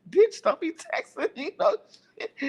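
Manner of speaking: high, indignant friend imitation; mock scolding. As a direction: play the offended friend telling someone off. Acts out Demetra's angry text.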